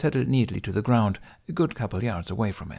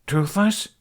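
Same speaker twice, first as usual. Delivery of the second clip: calling out; 11 semitones higher, 5 dB louder than usual. Acted. Calling out for missing dragon.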